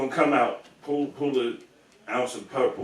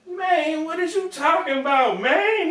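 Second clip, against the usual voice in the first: much higher, incredulous put-on voice; Crunchy's baffled voice; 4 dB louder, slower than usual. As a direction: squeak out his disbelief. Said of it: "Crunchy gonna say".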